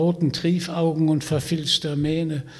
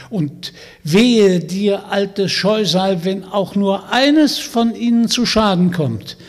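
stern threat; 5 semitones higher, 6 dB louder than usual. Acted. Vanya threatening the witch, stern and loud. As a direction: lay down the law to the old hag.

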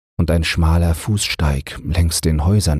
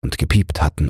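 usual speaking voice

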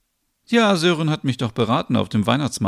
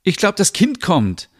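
mock alarm; 9 semitones higher than usual. Fake alarm prank on friends.

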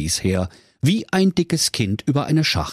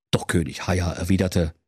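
plain reading voice

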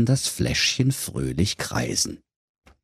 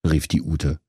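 plain reading voice

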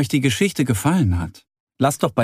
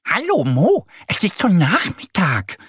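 giggling duck; 6 semitones higher than usual. Acted. Annie teasing sleepy Mo, high giggly duck voice. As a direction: tease him with a giggle in your voice. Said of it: "kichert Annie".

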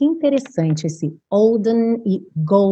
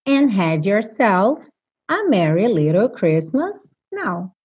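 slow lyric reading; much slower than usual. Shown as a demonstration. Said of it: "lyric read slowly".

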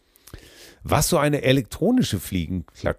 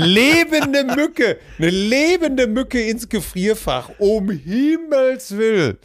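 mock shocked; 12 semitones higher, 3 dB louder, slower than usual. Reading out a listener's shocked letter.

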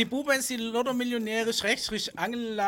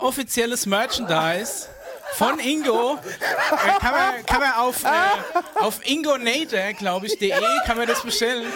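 louder, laughing through the words; 3 semitones higher, 5 dB louder, slower than usual. Real, not acted. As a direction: giggle while presenting. Presents mug laughing.

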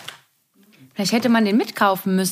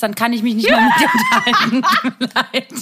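laughing; 3 semitones higher, 4 dB louder, faster than usual. Real, not acted. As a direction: talk through laughter. Laughs while talking.

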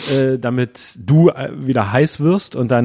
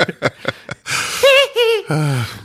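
high laugh; 12 semitones higher than usual. Real, not acted. Laughs after his parody.

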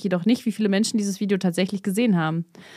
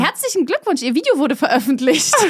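bright, sing-song automated congratulation; chirpy app voice, higher; cheerful notification voice; 5 semitones higher, 4 dB louder than usual. Retold or imitated. Phone reads out a cheerful congratulation, chirpy. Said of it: "freudig und mit den Worten".

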